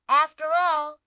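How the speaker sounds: plain reading voice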